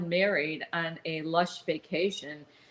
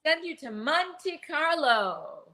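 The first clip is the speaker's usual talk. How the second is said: game show host; 8 semitones higher than usual. Retold or imitated. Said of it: "I think he even says".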